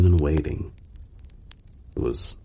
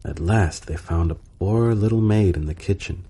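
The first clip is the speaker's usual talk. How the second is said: usual speaking voice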